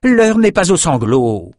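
high, slow impatient rebuke. A narrator's reading. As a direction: cut in on the mourning. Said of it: "coupa Olgia impatiente".

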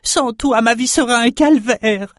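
high, pleading character voice. Acted. Narrator voices a despairing character, high. Character line in read story.

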